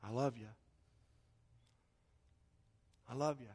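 very soft, low declaration of love; hushed fatherly tenderness; tender reassurance. Acted. God speaking to a believer's heart, hushed and tender. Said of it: "He'll say something like this".

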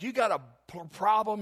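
retold yell, half-done. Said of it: "he yells at my preacher".

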